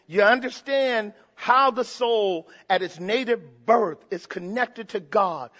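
normal talking voice

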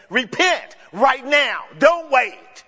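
preacher's firm imperative; urgent command. Retold or imitated. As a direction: order them to act now. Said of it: "Peter is saying".

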